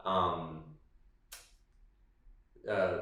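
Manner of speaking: low, soft tired voice; tired outro. Real, not acted. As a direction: wrap up wearily, half-mumbling. Recorded outro, quieter and low.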